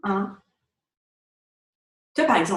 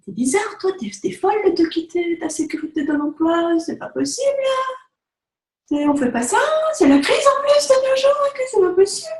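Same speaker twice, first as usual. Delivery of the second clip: higher and louder, aghast reproach; incredulous family telling her she's crazy to quit a secure job; mocking disapproving relatives; 11 semitones higher, 4 dB louder than usual. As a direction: mimic the shocked family scolding her. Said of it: "c'était un petit peu".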